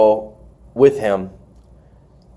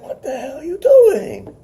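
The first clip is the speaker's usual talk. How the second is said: high-pitched mock squeal of disbelief; startled reaction; 13 semitones higher than usual. Wrestler uncovered on the plane, startled high squeal. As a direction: squeak it out, comically aghast. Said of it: "He's like".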